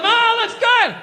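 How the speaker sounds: oversell it to the wife; mock overjoyed husband lying; fake enthusiasm. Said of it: "you lie and you tell him".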